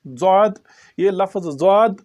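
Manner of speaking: ordinary delivery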